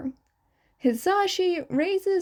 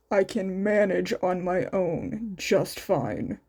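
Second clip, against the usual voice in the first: lower, grumpy stubborn man's voice; stubborn grumbling Shota; 5 semitones lower than usual. Acted. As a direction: grumble it like a sulky patient. Shota's voice in story.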